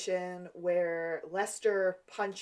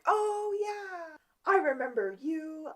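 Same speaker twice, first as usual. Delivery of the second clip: high, bright mock recognition; fake remembering; 10 semitones higher, slower than usual. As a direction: pretend you suddenly remember him. Professor suddenly pretends to remember.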